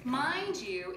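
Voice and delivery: usual speaking voice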